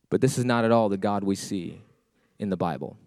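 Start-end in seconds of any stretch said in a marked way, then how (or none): none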